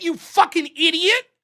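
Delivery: usual speaking voice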